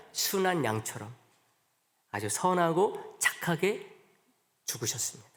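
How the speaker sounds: soft, slow and fading; hushed reverent close; tender, slowing. Acted. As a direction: lay the words down gently like a lamb. Tender picture of Jesus' death.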